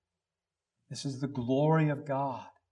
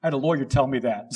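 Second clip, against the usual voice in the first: ordinary talk, nothing marked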